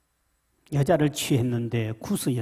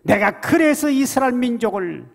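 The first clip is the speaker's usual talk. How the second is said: full-force scripture reading; 9 semitones higher, 7 dB louder than usual. Acted. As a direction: proclaim the verse to the church. (천천히 힘차게 외치는 성경 낭독)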